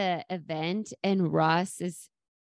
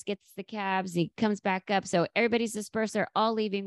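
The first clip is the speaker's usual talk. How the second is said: ordinary delivery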